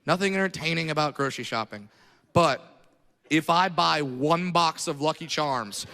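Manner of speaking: normal talking voice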